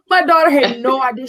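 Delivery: ordinary talk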